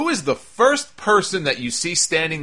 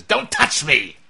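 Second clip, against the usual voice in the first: snapping; 2 semitones higher, 3 dB louder, slower than usual. Retold or imitated. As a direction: imitate an affronted wrestler snapping. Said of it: "Breeze just snaps at him".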